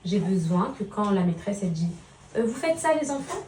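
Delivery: calm him so he listens; gentle mother talk. Real, not acted. Mother talks softly to her son about school.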